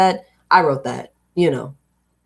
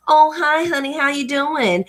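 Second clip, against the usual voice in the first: bright, higher friendly Southern hello; Southern greeting; 8 semitones higher than usual. Retold or imitated. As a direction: greet a stranger like a sweet Southern neighbour. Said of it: "standard manners to smile at people and engage".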